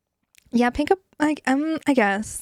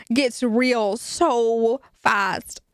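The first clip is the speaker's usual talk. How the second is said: slowed for emphasis; much slower than usual. Real, not acted. Stressing how quickly it escalates.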